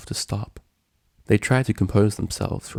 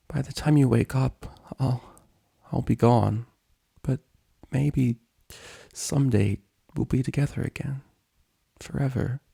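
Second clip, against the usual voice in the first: tearful farewell; 5 semitones higher than usual. Acted. Acted tearful farewell promise.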